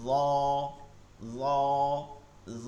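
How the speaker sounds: "র" soft but thick; soft and heavy. Shown as a demonstration. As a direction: demonstrate "র" with a soft, full, heavy sound. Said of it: "এটা হচ্ছে নরম ও মোটা আওয়াজে". ("র" নরম ও মোটা আওয়াজে)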